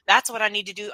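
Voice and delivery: usual speaking voice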